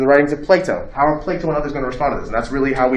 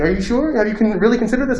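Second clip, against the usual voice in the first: Socrates probing; 8 semitones higher than usual. Retold or imitated. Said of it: "Socrates asked him".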